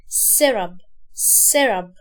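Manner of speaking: سيرب low and firm as "serab"; light short vowel. Shown as a demonstration. Teacher modelling the whole word, short vowel in سيرب. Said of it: "مش هتكسر هنا قوي".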